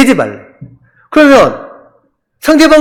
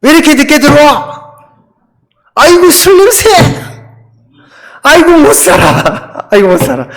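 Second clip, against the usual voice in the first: high, exasperated scolding of a husband; nagging wife; 7 semitones higher, 3 dB louder than usual. Acted. Wife to husband home late and drunk, high exasperated nagging. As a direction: wail in despair at him coming home drunk. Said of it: "근데 아내가 이렇게 합니다".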